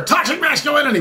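high, mocking cry of the phrase; mock scream. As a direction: mimic the people screaming it. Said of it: "these people screaming over here".

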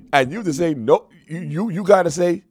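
ordinary talk, nothing marked

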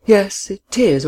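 drawn out and slow; slow, deliberate start. A narrator's reading. Woman turning to challenge him, slowly. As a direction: ease into the words slowly. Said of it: "she began".

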